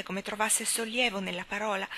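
plain reading voice

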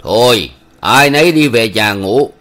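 loud threatening order. A narrator's reading. The village official threatening the crowd to go home, loud stern order. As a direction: bark the threat of a fine. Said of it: "Ông Chánh hội dọa".

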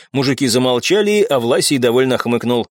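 usual speaking voice

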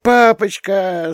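put-on high drawn-out child's squeal; a tiny girl squeaking for daddy, slow and high; little daughter squealing as she runs to dad. A narrator's reading. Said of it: "запищала Лея".

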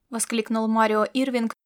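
ordinary delivery, nothing marked

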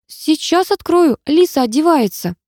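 high, drawn-out squeaky call; squeaking. A narrator's reading. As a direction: squeak it out in a panic. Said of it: "пропищала я".

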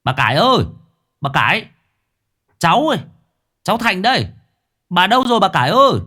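put-on loud, high calling for the old woman. A narrator's reading. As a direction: shout through the gap in the gate. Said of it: "Đoạn nó kêu lên". (cậu bé gọi to qua khe cửa, giọng cao và lớn)

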